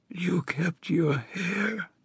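high hoarse whisper. A narrator's reading. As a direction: breathe it out as a whisper. Dying woman touches his hair.

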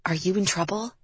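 soft, confidential question; hushed whisper. A narrator's reading. Friend privately asks Liv in the passenger seat, hushed whisper. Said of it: "Shannon turned to Liv in the passenger seat and whispered".

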